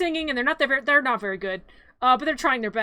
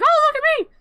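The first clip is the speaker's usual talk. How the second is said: much higher and louder, a squeaky cry of delight; Sally's squeaky line; 14 semitones higher, 7 dB louder than usual. Retold or imitated. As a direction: squeal it out like a giddy doll. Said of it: "and she's like".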